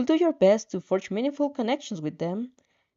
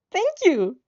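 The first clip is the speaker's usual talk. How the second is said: bright, high burst of thanks, a little louder; beaming thanks; 10 semitones higher, 5 dB louder than usual. Acted. Heroine thanking Shoto, beaming and excited. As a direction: thank him with a big beam, pitch jumping up. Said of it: "you beam".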